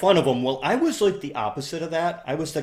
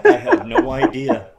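high squeaky reply through real laughter; can barely get it out for laughing; helpless giggly answer; 9 dB louder than usual. Real, not acted. Own reply, cracking up laughing.

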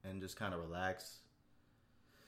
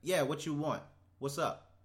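blunt greeting; 6 semitones higher, 4 dB louder than usual. Pretend. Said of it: "I really wanna be like".

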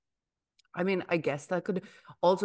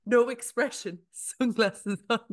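laughing; 8 semitones higher, 3 dB louder than usual. Real, not acted. Laughing at her hungover friend.

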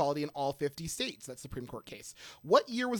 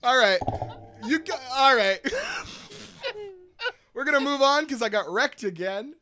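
laughing; 7 semitones higher, 8 dB louder than usual. Real, not acted. Host cracking up after joke.

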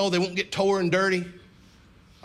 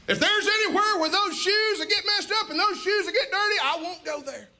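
put-on pleading child; 9 semitones higher than usual. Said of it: "pretend like".

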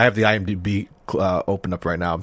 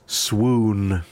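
swooning. Acted. Host reading a note on Connery's singing, swooning. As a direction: stretch it out like a smitten fan.

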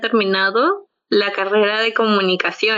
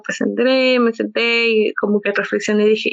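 usual speaking voice

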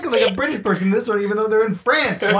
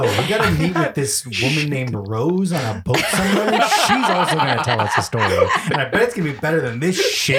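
mock Brothers Grimm; 6 semitones lower than usual.

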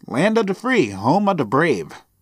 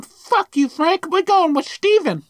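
put-on high kid voice; 13 semitones higher than usual. Kids mocking Frank in quote.

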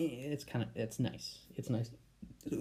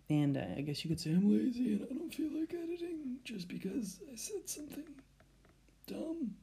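high embarrassed voice; 13 semitones higher than usual. Real, not acted. Embarrassed confession, fading out.

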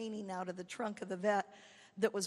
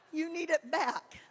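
laughing disbelief; 6 semitones higher, 4 dB louder than usual. Retold or imitated. Disbelief at check returned.

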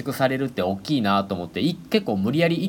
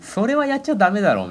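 scolding; 11 semitones higher, 3 dB louder than usual. Retold or imitated. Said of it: "それはやめてって言った".

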